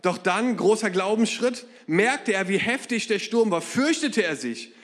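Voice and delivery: usual speaking voice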